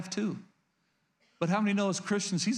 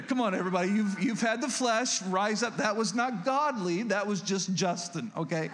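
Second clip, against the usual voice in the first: ordinary talk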